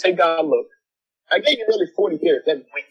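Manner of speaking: rising, laughing exasperation; exasperated plea. Retold or imitated. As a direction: throw up your hands at God. Retold words to God, exasperated.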